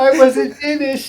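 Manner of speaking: slow, high, whining "I wasn't finished."; high whiny complaint. Acted. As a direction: whine it like a frustrated character. Joking character voice.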